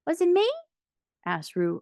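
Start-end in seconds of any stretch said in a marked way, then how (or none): 0.1-0.5 high, squeaky question; small child's startled query; a little surprised. A narrator's reading. Young Roo scolded by mother, high and surprised. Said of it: "a little surprised".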